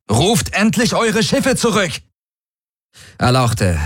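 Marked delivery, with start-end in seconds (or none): 0.1-2.0 very high, urgent cry; exasperated call. A narrator's reading. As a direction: call out a desperate order, pitched right up. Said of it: "rief seine Projektion". (aufgebracht und hoch gerufen)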